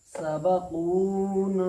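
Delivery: सबकूना slow and loud, the long vowels held; stretched madd recitation. Shown as a demonstration. Teacher models the correct reading of सबकूना, slow and loud. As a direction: draw out कू and ना in सबकूना. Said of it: "क्या?".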